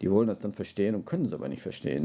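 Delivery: ordinary talk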